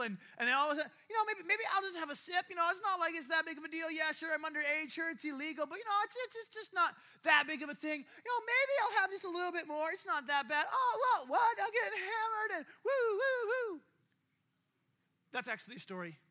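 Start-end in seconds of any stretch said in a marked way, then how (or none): 1.1-10.7 raised-pitch excuse-making; mock rationalizing. Underage student justifying a sip, high-pitched mock excuses. Acting someone talking himself into drinking. 10.7-13.8 put-on high, loud drunken whooping; hammered partygoer. Underage student getting hammered at the party, high drunken whooping.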